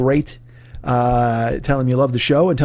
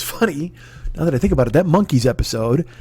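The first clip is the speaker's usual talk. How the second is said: normal talking voice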